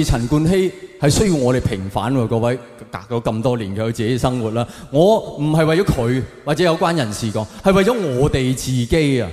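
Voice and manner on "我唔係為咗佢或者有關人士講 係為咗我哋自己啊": ordinary talk, nothing marked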